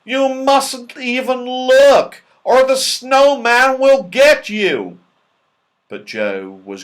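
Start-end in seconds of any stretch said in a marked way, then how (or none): 0.0-5.0 loud, slow, high alarm; frightened warning. A narrator's reading. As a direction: warn them in fright. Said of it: "in fright".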